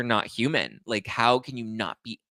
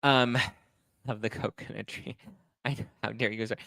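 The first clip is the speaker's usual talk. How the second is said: ordinary delivery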